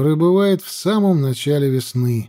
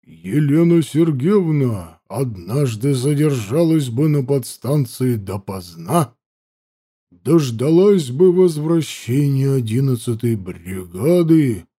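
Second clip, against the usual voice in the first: sinister voice; slower than usual. A narrator's reading. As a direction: spin it like a dark villain's tale. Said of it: "зловещим голосом начал Данилов".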